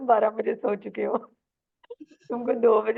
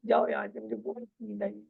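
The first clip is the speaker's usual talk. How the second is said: irritated and sleepy. Shown as a demonstration. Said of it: "मतलब irritate हो रहे हो अब कि".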